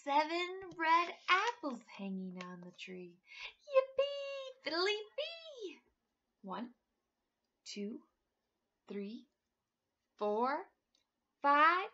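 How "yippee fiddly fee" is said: high delighted cheer; high happy yippee; gleeful refrain. Acted. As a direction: cheer it like a celebration. Refrain shouted with glee.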